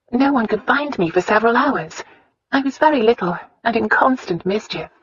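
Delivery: put-on earnest young wife recounting; Christina's voice. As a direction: lift the pitch, earnest storytelling heroine. Voices Christina telling her story.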